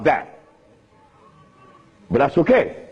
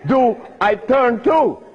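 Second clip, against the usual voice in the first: slow; 8 semitones higher, much slower than usual. Real, not acted. Mock dilemma after joke.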